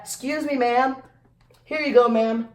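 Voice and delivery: high polite clerk voice; light imitation of a cashier. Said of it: "And that".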